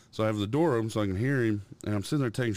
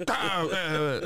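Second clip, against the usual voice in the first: put-on toddler babble; 8 semitones higher, slower than usual. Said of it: "slapping his table, like".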